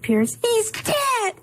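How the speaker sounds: high, slow retold cry; mild mock scream. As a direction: stretch it out high like a mother's cry. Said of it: "she screamed as loud as she could".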